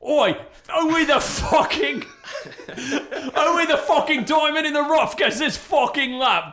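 high, loud cockney roar, laughing; cockney tiger shout. Pretend. The cave tiger guarding the lamp, shouting in cockney. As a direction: bellow it like a drunk geezer at the pub. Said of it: "he's like".

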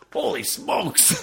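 very high, slow mock exclamation; Stan Lee impression. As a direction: stretch it out in a squeaky amazed voice. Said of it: "and he goes".

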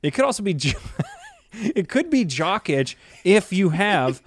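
giggling through the words; laughing while talking. Real, not acted. Laughing through jock itch joke.

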